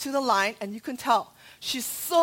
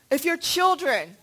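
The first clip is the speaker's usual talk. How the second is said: normal talking voice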